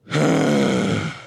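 long, breathy exhaled sighs; big audible breaths out; heavy sighs. Retold or imitated. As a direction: imitate an actor sighing with every breath. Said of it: "it was all sighs. Every breath".

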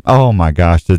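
normal talking voice

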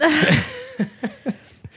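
high, light burst of laughter. Real, not acted. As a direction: laugh at your own jab. Laughs after blaming her.

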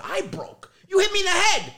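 high-pitched, loud mock outrage; indignant protest. Son retells protesting to dad, indignant yell. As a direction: protest shrilly at the top of your range. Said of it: "I said".

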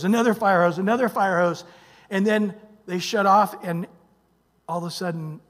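ordinary talk

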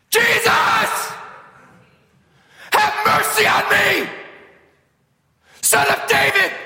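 very high, loud desperate shriek; shrieking beggar crying for mercy, making a baby cry; full shriek. Shown as a demonstration. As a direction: scream it at the top of your lungs. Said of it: "I did that because I want you to see, like, how obnoxious".

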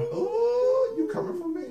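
high loud ooh, playfully affronted; mock offended surprise. Real, not acted. Reacting to a friend's jab with mock offence. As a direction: act stung by the diss.

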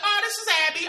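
a lightly played girl answering cheerily; mock perky hotel girl. Imitating Abby on phone.

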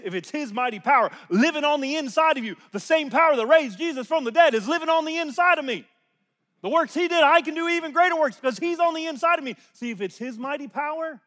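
high, loud, shouted proclamation; preaching at full force. Real, not acted.

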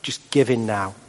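low-pitched, crushing tempter's voice; a lightly acted crushing inner voice, low. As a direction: press them to give up, pitched well down. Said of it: "There'll be the crushing voice".

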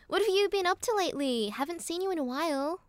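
American accent. Shown as a demonstration. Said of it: "Can you do an American accent?".

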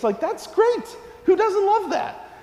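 exaggerated puppy delight; gushing. Real, not acted. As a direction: sell the cuteness with delight. Gushing over puppies.

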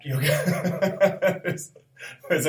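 breaking into a laugh mid-word; words cut off by a real laugh. Real, not acted. Laughing at student chair joke.

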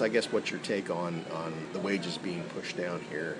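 ordinary delivery

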